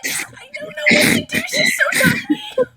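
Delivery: loud, high, laughing panic; laughing in real panic; panicked laughing shout. Real, not acted. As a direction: shout through nervous laughter.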